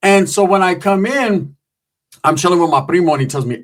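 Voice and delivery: ordinary talk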